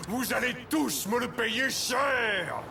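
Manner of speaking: slow shouted threat, pitch high; furious threat. Acted. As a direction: bellow the threat slowly. Villain vows revenge.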